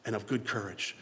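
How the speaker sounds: God quietly encouraging a scared Joshua; gentle exhortation. Retold or imitated. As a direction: say it softly and encouragingly. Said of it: "He says".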